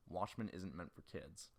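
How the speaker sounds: usual speaking voice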